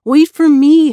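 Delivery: high, loud yell after her; a boy yelling to be waited for. A narrator's reading. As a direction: shout to catch up. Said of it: "Humpy yelled".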